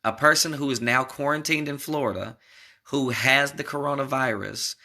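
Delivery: usual speaking voice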